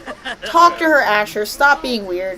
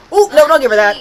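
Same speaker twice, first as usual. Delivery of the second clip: sudden loud "no, don't!"; alarmed shout; 4 semitones higher, 8 dB louder than usual. Real, not acted. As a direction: jump in loudly to stop her. Stopping sim giving bad gift.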